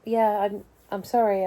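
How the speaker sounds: ordinary talk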